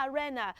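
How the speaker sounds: "arena" as "a-REN-uh"; short e in the middle of "arena"; wrong pronunciation. Shown as a demonstration. Teacher recapping the mistake, wrong pronunciation. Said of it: "it is not pronounced as".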